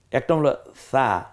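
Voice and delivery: a gentle সা, airy and soft; soft sa sound. Shown as a demonstration. Teacher showing students a soft সা. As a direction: say সা with a soft, light s. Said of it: "নরম আওয়াজে".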